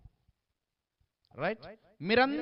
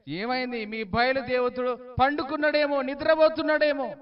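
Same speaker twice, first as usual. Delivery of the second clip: loud, higher mock concern; mocking taunt; 5 semitones higher, 5 dB louder than usual. Elijah taunting the prophets of Baal, loud mocking jeer. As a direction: jeer at them, pretending to wonder.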